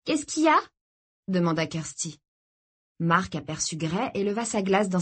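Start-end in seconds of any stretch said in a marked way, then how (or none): 0.1-0.6 put-on high-pitched girl's question; young sister's higher voice; curious girl. A narrator's reading. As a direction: ask it high, as the puzzled sister. Girl asks brother what's wrong.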